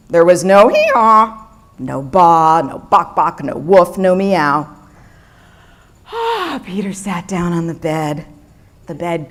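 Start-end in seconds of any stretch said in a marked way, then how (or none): none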